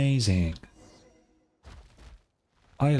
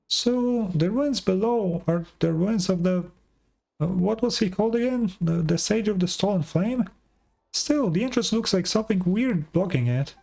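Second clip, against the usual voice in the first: high, rambling little sidekick voice; high chattering companion; 9 semitones higher than usual. Acted. Sidekick tries to recall the sage's name, high and chatty. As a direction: puzzle over the name in a squeaky voice.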